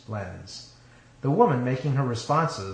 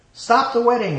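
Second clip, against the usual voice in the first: high, loud commanding call; authoritative demand; 12 semitones higher, 9 dB louder than usual. A narrator's reading. Man bursting in on a wedding, loud authoritative demand. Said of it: "an authoritative voice demanded".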